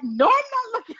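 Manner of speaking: husband turning down his wife, high mock refusal; squeamish husband. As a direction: recoil from the idea of looking.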